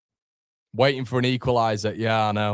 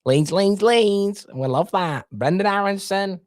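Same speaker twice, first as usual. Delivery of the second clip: mock Manc accent; 7 semitones higher than usual. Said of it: "Can you hear it in the accent?".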